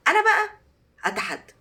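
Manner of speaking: high, loud me, well; daring the viewers, high and loud; bold challenge. Real, not acted. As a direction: throw down the gauntlet. Teacher launches a challenge.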